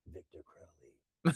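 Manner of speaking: very soft, slow, drawn-out name; ominous mock murmur. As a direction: imitate a dramatic close-up, hushed and slow. Imitating the ominous close-up line.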